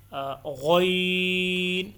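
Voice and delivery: غوین said very slowly and thickly from the throat; throaty drawn-out letter. Shown as a demonstration. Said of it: "غوین بھی عین سے تھوڑا سا اوپر کر کے حلق سے ادا ہوتا". (غوین کو حلق سے موٹا اور لمبا پڑھیں)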